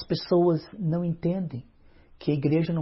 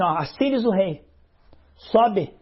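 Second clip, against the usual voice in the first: a captain ordering the prophet down, louder; louder bossy summons; 3 semitones higher, 5 dB louder, slower than usual. Acted. Second captain orders Elijah to come down, louder bossy call. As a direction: call him down with royal authority.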